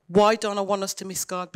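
ordinary delivery

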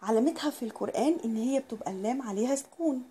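usual speaking voice

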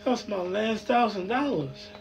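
slow, soft despair. Acted. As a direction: let it sink in, crushed. Despair over lost money.

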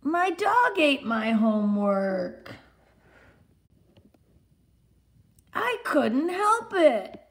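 put-on high, slow little boy pleading; a small boy whining excuses, drawn out; David's excuses. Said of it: "he always says".

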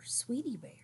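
hushed, secretive name; whispered wondering. A narrator's reading. Brother wondering if a cheering girl is his admirer, whispered.

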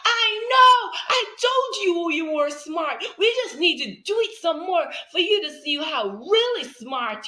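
very high, loud cheering; thrilled praise. Retold or imitated. Teacher cheering a child who just read. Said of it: "I said".

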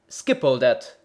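loud, impatient interruption; a loud hurried shout; hasty cry. A narrator's reading. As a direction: cut him off loudly. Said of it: "cried the bellman in haste".

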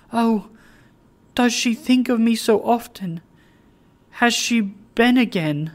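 eager cry. Acted. Meg begging Richard for news of her sister, eager and high. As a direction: plead with sudden hope. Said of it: "clasping her hands".

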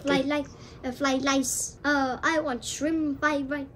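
mock Chinese-accented takeout order. Caller ordering seafood, in a Chinese accent. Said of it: "I know the accent is like this".

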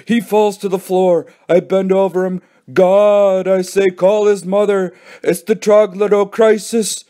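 high-pitched mock alarm; melodramatic crying out. A narrator's reading. Joe acting out Billy collapsing on the floor, melodramatic. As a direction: play up the pretend emergency. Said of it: "cried Joe".

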